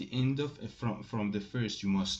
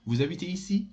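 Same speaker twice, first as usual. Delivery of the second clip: "Vous habitez ici?" louder, climbing high on "ici"; rising question intonation; 6 semitones higher, 5 dB louder, much faster than usual. Shown as a demonstration. French teacher demonstrates making the voice up, rising question intonation. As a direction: send "ici?" right up, firmly. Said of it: "you will make your voice up".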